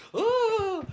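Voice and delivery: loud, very high-pitched "oh"; girly squeal. Retold or imitated. Friend running up the stairs in fright, mocking girly squeal. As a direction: squeal like a little girl fleeing upstairs. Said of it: "I heard his little girly voice".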